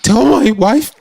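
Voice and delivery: mock sobbing groom, pitched high; hammed-up tearful vows; breaking down. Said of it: "breaking down and talking like".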